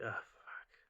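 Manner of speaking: softly breathed-out curse; voiceless, deflated mutter; whispered dismay. Retold or imitated. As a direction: let the curse out under your breath, retelling dismay. Retold dismay at friend being trapped.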